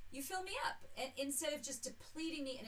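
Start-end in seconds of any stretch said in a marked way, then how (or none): 0.1-0.8 quick, high-pitched jab; mocking quote. Daughter mocking the cliché, high quick jab. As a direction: toss it off high and fast, making fun of it. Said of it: "It's like every song".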